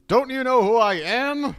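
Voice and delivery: high, loud, hammed-up self-importance; mock celebrity indignation. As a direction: play the outraged star demanding recognition. Mock self-important famous person.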